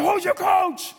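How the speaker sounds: high, insistent acted shout; mock shouted demand. Coach demands player name him as coach, mock shouted demand. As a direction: play a coach yelling for the answer. Coach repeats challenge, escalating.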